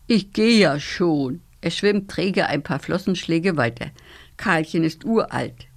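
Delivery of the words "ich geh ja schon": slow, sleepy yawn through the words. Acted. Old carp yawns at the nymph, slowly giving up the nest. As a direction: drag it out mid-yawn. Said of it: "gähnt".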